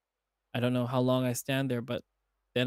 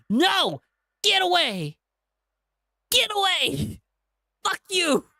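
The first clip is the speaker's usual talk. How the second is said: high, loud, frantic cries to get away; shrill terrified shout of a cornered lynx; panicked screaming; 6 dB louder, slower than usual. Acted. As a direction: scream it in terror, pushing him off. TJ panics as Chase reaches.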